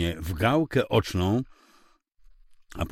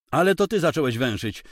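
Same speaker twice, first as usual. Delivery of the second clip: higher, louder cutting reply; snapping back; 7 semitones higher, 4 dB louder than usual. Acted. Wife snapping back at her husband. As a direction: cut him off sharply. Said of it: "odcięła się".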